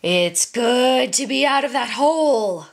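menacing growled animal voice; growling tiger. A narrator's reading. Tiger gloats over the pinned merchant, growling. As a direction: growl it with predatory relish. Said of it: "growled the tiger".

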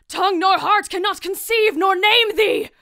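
high, strained cry of horror; too appalled to find words; high anguished outcry; aghast outcry. Acted. Discovering the murdered king.

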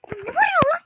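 high, loud complaint; annoyed outburst. Acted. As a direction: demand to know why they're yelling. Complains about the yelling.

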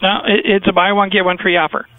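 ordinary talk

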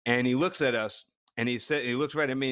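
normal talking voice

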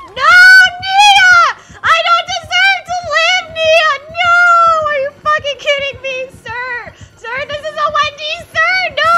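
high, full-volume gamer scream; over-the-top screamed protest; yelling. Real, not acted. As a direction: shriek in outrage at the game. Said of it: "Calamity, I yell a lot".

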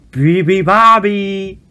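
loud, high mock roar. A narrator's reading. Lion roaring the song to wake the bird, loud. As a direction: roar the bird's song back. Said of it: "roared the lion".

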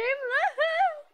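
sudden high loud laugh; high, loud burst of laughter. Real, not acted. Laugh after "Big game!.

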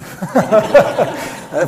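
loud high laugh bursting out; burst of laughter. Real, not acted. Laughing at the memory.

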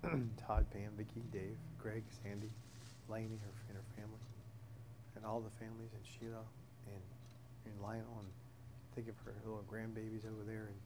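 earnest spoken prayer, soft and halting. Real, not acted. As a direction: pray it quietly, pausing between names. Closing prayer before Bible study.

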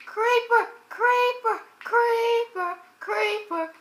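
high, loud alarmed calls of the word; fading repeated warning shouts; shouting creeper. Real, not acted.

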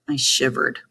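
usual speaking voice

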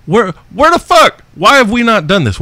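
high, loud, delighted yell; thrilled host hollering at the listener's wine idea; excited shout. Real, not acted.

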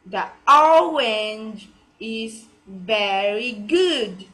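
very slow, ORANGE with a long drawn-out O; slow teacher's model, long O in "orange"; correct pronunciation. Shown as a demonstration. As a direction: draw out "orange is very good" for learners. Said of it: "Like this, you have to pronounce correctly".

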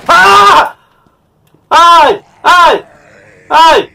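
shrill, loud terrified yelps; scream of fright. Real, not acted. Player startled by a monster, high frightened screams. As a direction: scream as something jumps out at you. Jump scare in horror game.

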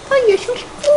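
mock little-kid begging voice; high pleading ask. Repeating the kid's request.

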